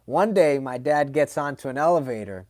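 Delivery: ordinary talk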